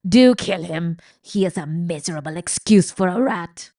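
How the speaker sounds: loud scornful shout. A narrator's reading. Old rat shouting the mouse should kill. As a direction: sneer it out loudly. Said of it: "shouted Botticelli".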